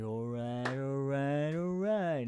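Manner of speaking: lightly imitated Texan drawl; a hint of lazy Southern drawl; McConaughey drawl. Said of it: "This is his Midwestern accent".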